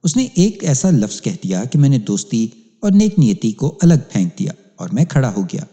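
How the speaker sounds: ordinary delivery, nothing marked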